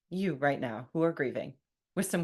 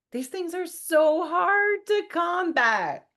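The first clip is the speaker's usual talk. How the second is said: high, slow, strained outburst; exasperated; 11 semitones higher, 8 dB louder, slower than usual. Real, not acted. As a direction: let it burst out high and drawn out.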